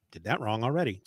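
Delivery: normal talking voice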